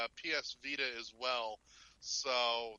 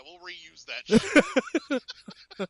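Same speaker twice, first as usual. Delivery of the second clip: drawn-out, much louder punchline; slow loud joke; 6 semitones higher, 14 dB louder, much slower than usual. Real, not acted. Cohost joking about reusing the jingle, slow and loud. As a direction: stretch every word and belt it for the laugh.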